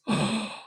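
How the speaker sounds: sharp, high mock gasp; worked-up gasp. Reacting to someone's hurtful remark, mock offended gasp. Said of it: "you're all worked up by it".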